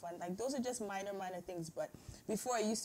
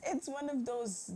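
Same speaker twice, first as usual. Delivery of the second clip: normal talking voice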